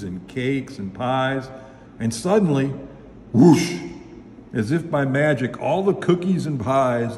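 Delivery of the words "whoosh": loud, sudden whoosh; imitated rush of air, punched loud. A narrator's reading. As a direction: burst out a whooshing sound effect.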